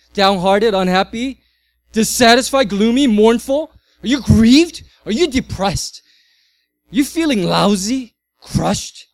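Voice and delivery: swelling pulpit litany; building preaching. Acted. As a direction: preach the list with rising force. Rising list of sad words.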